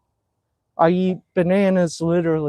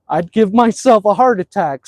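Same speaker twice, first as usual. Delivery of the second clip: ordinary delivery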